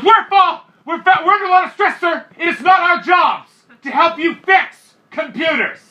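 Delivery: high, loud, frantic clerk rant; yelling. Retold or imitated. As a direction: shout down a customer in an overworked outburst. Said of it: "why are you yelling".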